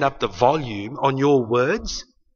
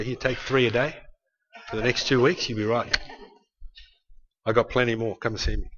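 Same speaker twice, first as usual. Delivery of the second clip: soft, lower casual doctor voice; mock doctor; 6 semitones lower, 9 dB quieter than usual. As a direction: hand out pills offhandedly, quietly. Mock doctor handing out pills.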